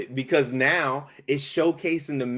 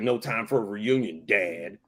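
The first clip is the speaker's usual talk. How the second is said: low, dismissive imitation of a son; curt brush-off; 5 semitones lower, 4 dB quieter than usual. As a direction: drop low and dismiss him. Acting out Cable brushing off father.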